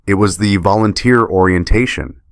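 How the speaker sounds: usual speaking voice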